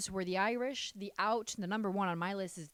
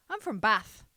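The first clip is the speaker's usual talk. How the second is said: "Bath" said with a flat short a, louder; other English accent; 6 dB louder than usual. Retold or imitated. Trying the other way an English person might say it, flat "Bath". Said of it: "or do you say Bath?".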